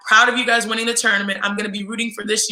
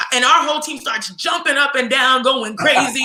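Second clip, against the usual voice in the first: usual speaking voice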